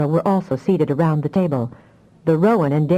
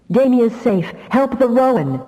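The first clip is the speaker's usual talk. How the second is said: urgent cry; 6 semitones higher than usual. Acted. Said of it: "Afra cried".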